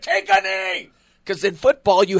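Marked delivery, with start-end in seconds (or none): 0.0-0.9 retold coach scream. Coach yelling at the kicker on the sideline, screamed order. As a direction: yell it like a big scary coach. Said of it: "He's like".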